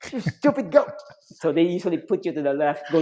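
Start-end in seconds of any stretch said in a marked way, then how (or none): 0.1-0.9 much higher, scornful yell; angry insult. Retold or imitated. Instructor scolding the recruit at the poolside, high scornful yell. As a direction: spit it out at the recruit.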